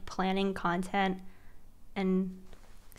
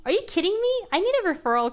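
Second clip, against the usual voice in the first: high, loud outburst of disbelief; exasperated shout; 9 semitones higher, 8 dB louder, faster than usual. Real, not acted. Fed up with no referral code, she yells in disbelief. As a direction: throw the question up in a high yelp.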